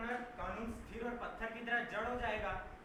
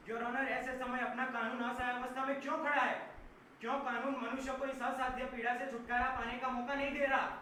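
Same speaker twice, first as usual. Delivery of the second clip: raised, louder courtroom outburst; impassioned challenge; 3 semitones higher, 5 dB louder than usual. Acted. Lawyer asking the judge why the law stands helpless, loud and impassioned. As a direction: demand an answer, voice raised.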